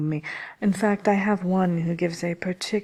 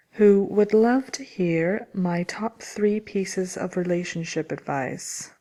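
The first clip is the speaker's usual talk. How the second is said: ordinary talk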